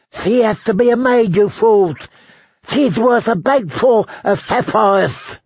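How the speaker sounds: high lisping screech; high-pitched, slobbering outrage; screaming, lisping goat. A narrator's reading. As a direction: scold the fools with a spluttering lisp. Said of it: "the goat screamed".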